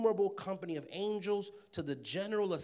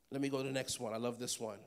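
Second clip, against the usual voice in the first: normal talking voice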